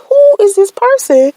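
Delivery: high, indignant inner voice; mock outrage at a late-night caller; incredulous. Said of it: "I'm like".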